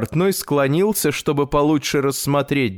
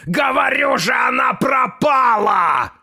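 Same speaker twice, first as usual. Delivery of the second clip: exasperated yell; 11 semitones higher than usual. A narrator's reading. Grandfather tells tailor of his lost hat, exasperated yell. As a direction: cry out in exasperation, pitched high. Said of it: "завопил дедушка".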